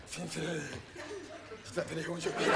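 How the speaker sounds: soft mock plea through laughter; laughing confession. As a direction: whisper it while cracking up. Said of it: "運ばれてる最中に". (笑いながら小声で)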